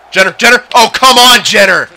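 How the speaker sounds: calling a teammate loudly, rising; loud exasperated calls to a teammate; frustrated yelling. Real, not acted. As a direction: shout at the teammate who ignored you. Teammate misses open pass.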